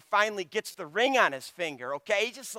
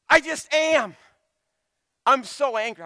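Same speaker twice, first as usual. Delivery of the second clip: higher, louder petulant outburst as Jonah; sulky anger; 6 semitones higher, 7 dB louder than usual. Retold or imitated. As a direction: huff it out like a sulking prophet. Jonah voiced sulking in anger.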